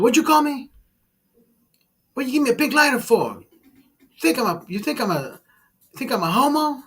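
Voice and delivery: high, indignant mock voice of a touchy customer; offended customer. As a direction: squeak out the outrage. Offended customer in retold video.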